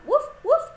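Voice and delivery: high, loud woofs; playful loud dog imitation; dog barking. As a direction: bark like an excited little dog.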